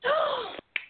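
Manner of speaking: sharp high gasp of delight; surprised gasp. Real, not acted. Host gasps as her guest Billy comes on, delighted gasp. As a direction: gasp as if a friend walked in.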